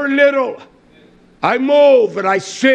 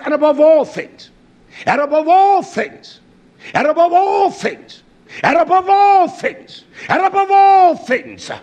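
building emphasis; 6 semitones higher than usual. Real, not acted. Preacher repeats phrase, rising.

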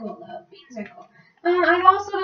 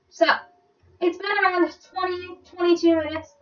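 usual speaking voice

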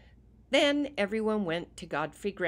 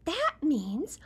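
whispery porcupine voice; 11 semitones higher, 6 dB louder than usual. Acted. Porcupine character voice.

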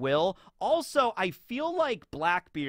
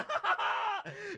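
screaming mad laugh; 5 dB quieter than usual. Acted. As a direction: imitate a madman's screeching laugh. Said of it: "He's over there screaming".

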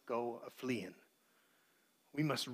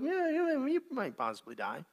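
dismissive shrug; 8 semitones higher, 5 dB quieter than usual. Acted. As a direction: wave the threat away lightly. Mocking Eve's changed words.

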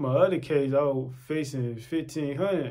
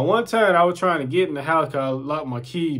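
normal talking voice

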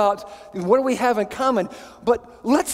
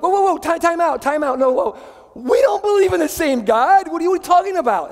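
alarmed administration; 7 semitones higher than usual. Retold or imitated. College administration rebuking the professor, high alarmed protest. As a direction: play the shocked college bosses calling time out. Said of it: "the administration was like".

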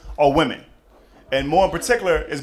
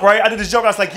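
ordinary talk, nothing marked

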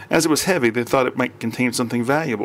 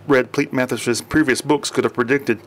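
ordinary delivery

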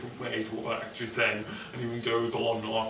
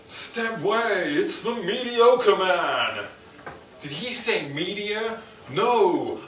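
high, slow, booming trailer voice; mock superhero announcer; 6 semitones higher, 4 dB louder, slower than usual. Announcing the mediocre man hero, hammy trailer voice. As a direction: oversell him like a cartoon hero intro.

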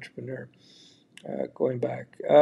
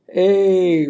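usual speaking voice

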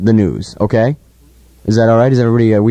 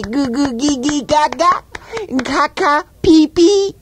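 put-on baby talk; slower than usual.

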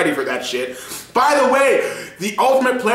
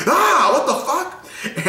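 high, slow, laughing what the fuck; laughing disbelief; 10 semitones higher, much slower than usual. Retold or imitated. Waking up to the Discord picture, slow laughing disbelief. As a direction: drag it out in laughing shock. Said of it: "I'm like".

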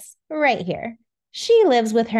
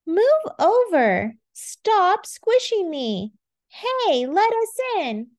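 high, pushy squabbling voices; whiny squabbling kids; 9 semitones higher, slower than usual. Acted. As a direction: shove and complain. Crowded glucose pushing.

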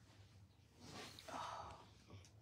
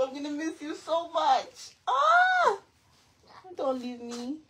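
tearful goodbye; 6 semitones higher, 6 dB louder than usual. Pretend. Mock sad goodbye to toddler.

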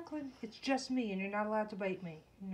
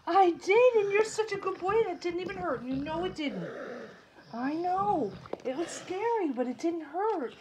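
high, loud celebration; delighted, raised-pitch pet talk; excited praise; 6 semitones higher, 10 dB louder than usual. Real, not acted. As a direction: cheer the pet on for being brave. Celebrating clipped nail with pet.

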